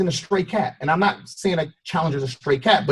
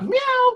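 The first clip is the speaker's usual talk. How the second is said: high, thin imitated meow. The stray cat answers, a high thin meow. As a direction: mew like a small wary cat.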